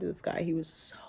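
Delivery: whisper. Real, not acted. Fond memory of Tyler.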